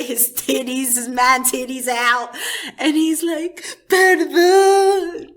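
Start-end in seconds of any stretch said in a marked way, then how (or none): 3.9-5.3 long, loud, high plea; hammed-up bellow begging for pardon; mock scream. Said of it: "he screams that shit".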